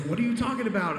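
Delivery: high, laughing disbelief; incredulous friend. Retold or imitated. Friend on set, high and laughing in disbelief. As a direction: squeak it out in disbelief. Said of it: "He's like".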